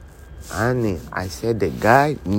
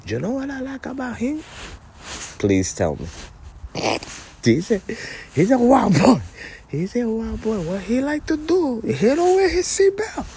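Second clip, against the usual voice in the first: high put-on voice; 13 semitones higher than usual. High voice praising wild Sammy.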